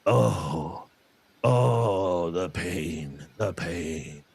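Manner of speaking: imitated moaning, suffering mother; mother in pain. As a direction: moan it weakly like a mother in pain.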